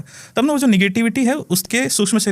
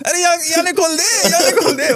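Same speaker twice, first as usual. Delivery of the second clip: much higher pleading ghost voice; pleading spirit; 11 semitones higher than usual. Retold or imitated. Spirit begs the healer to untie the thread, high pleading. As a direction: squeal like a trapped spirit begging.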